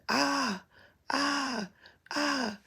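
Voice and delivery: restrained high "ah" cries imitating screaming; mock scream. Girl heard screaming in the crashed car, mock screams. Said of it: "I hear her screaming".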